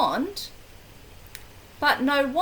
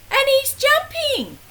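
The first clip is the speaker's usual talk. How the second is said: much higher, fast and louder, excited; mock alarm; 10 semitones higher, 4 dB louder than usual. Reader reacting to the jumping frog, high excited alarm. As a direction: rush in with breathless alarm.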